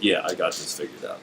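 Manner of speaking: casual mock confidence, pitched well down; self-assured part. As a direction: drop low and quiet, sure you've got it.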